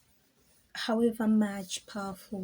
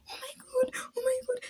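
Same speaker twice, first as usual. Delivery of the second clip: fan's high shrieking squeal; faster than usual. Retold or imitated. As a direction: squeal it like a starstruck fan. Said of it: "these people are like".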